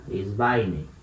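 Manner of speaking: "Esbaine" articulado com tônica em "bai", tom grave; pronúncia grega modelo. Shown as a demonstration. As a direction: diga "Esbaine" como modelo para a turma. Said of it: "Ele vai em direção a algum lugar". (professor mostra a terceira pessoa "Esbaine", grave e pausado)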